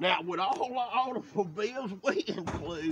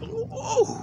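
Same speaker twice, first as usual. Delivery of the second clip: surprised ooh; 13 semitones higher, 5 dB louder than usual. Real, not acted. Surprised at how well it runs.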